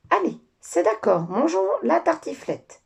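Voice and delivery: high and loud joyful cry; excited cry. Acted. Papa kangaroo crying out happily to eat the gratin. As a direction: shout it out in delight. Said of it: "s'écria papa kangourou".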